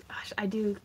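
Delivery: soft breathy whisper. Real, not acted. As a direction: breathe it under your voice. Softly admiring dreads.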